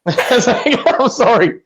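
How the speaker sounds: laughing apology, pitched high; real laughter while apologising. Real, not acted. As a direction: giggle through the sorry.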